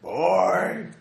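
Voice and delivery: mock shout. Echoing with a shout.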